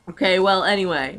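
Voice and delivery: normal talking voice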